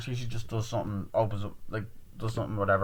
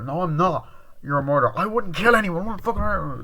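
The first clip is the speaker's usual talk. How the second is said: louder, rising to high mock protest; heated denial; 8 semitones higher, 6 dB louder than usual. Girl accused of being a killer, heated high denial. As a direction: deny it with growing acted desperation.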